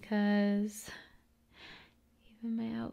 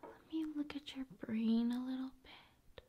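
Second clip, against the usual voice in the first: ASMR whisper; 6 dB quieter than usual. Acted. ASMR host pretending to examine viewer's brain, whispering. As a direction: whisper like examining them closely.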